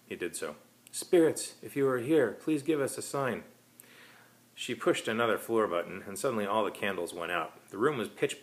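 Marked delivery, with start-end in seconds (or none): 0.9-3.4 summoning spirits. Acted. Medium calls on spirits.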